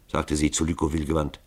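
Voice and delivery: plain reading voice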